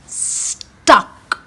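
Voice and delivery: lively storybook read-aloud for a class; animated picture-book reading; reading to children. Acted. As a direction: read it like storytime for little ones. Teacher reads picture book aloud.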